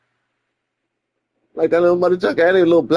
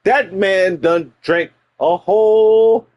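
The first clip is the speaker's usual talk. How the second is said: normal talking voice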